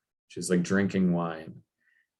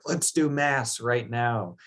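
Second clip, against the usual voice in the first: slow, higher, pushy demand; 4 semitones higher than usual. Retold or imitated. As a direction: insist on it like a brash youth. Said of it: "he just demands".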